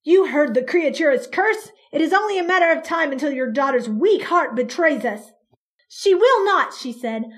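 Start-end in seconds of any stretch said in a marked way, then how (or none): 0.0-5.3 loud argument. Acted. Police chief arguing with mother. 5.9-6.7 defiant. Acted. Mother defiant against police.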